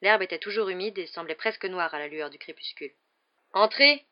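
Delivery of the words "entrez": a rough, growled word, higher and louder; half-hearted growl of a gruff giant. Acted. Hagrid growls at the door, gruff and loud. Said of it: "grogna Hagrid".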